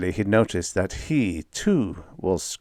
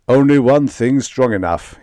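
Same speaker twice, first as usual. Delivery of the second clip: louder commanding bellow; 4 semitones higher, 8 dB louder than usual. Acted. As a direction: boom it out with authority. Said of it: "he bellowed".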